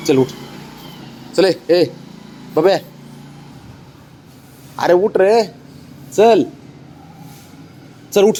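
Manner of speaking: coaxing pet talk. Real, not acted. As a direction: sweet-talk the stubborn dog up.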